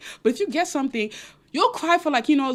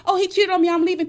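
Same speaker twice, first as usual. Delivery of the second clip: higher, louder whiny complaint; mock wronged woman; 7 semitones higher, 5 dB louder, faster than usual. Vlogger mocking a loud heartbroken woman, higher and louder. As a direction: ham up the loud protest.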